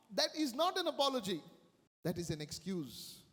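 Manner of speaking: normal talking voice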